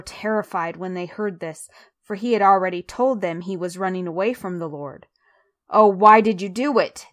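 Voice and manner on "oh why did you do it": loud, lamenting question; dismayed narrated groan, voice raised; groaned reproach. A narrator's reading. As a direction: read it as an exasperated groan. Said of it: "they groaned".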